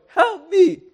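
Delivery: high, loud plea; desperate cry. Acted. Peter calling from the waves, high and loud. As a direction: cry out like you're going under.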